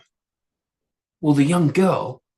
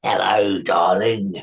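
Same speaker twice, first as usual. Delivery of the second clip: lightly voiced leering troll, a bit lower; a hint of a sly troll greeting; 6 semitones lower, slower than usual. A narrator's reading. Troll greeting the young girl, mildly leering. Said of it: "came this troll".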